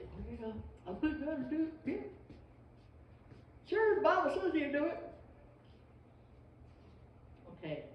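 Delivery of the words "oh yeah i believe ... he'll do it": half-hearted believer. Pretend. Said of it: "you can't just say".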